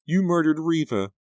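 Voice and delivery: high horrified outcry over a body; terrified cry. Acted. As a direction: scream it in horror at the killer. Pam accuses the killer.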